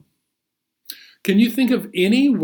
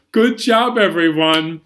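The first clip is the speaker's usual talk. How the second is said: normal talking voice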